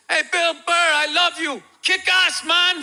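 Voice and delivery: high, loud call from the crowd; fan yelling out. Retold or imitated. Fan in the crowd calling to Bill Burr, loud and high. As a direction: shout it across the arena to the stage. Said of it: "this guy yells out".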